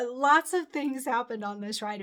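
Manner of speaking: normal talking voice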